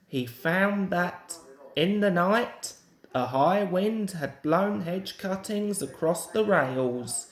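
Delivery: usual speaking voice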